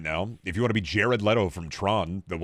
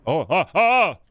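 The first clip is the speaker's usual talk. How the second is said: mock scream; 7 semitones higher, 7 dB louder than usual. Loud cry opening, in-game bit.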